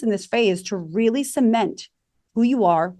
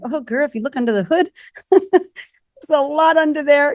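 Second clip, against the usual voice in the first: laughing confession; 8 semitones higher, 7 dB louder than usual. Retold or imitated. Confiding laugh about hidden past.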